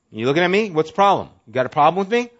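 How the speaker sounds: mock confrontation. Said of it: "They are looking for a fight".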